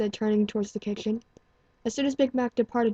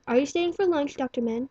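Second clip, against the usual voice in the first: calling out; 6 semitones higher than usual. A narrator's reading. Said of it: "she called".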